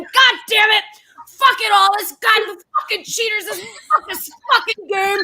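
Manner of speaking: high-pitched mock raging yell; imitated gamer rage. Partner raging at cheaters in late-night game, angry. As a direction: mimic a furious gamer cursing at cheaters. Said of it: "he's so fucking loud and he's so fucking angry".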